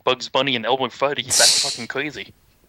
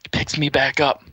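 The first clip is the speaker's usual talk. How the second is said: ordinary delivery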